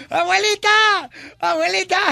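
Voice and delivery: grito agudo de niño llamando. Retold or imitated. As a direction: grita como niño que entra corriendo. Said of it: "empieza a gritar". (niño gritando agudo a la abuela)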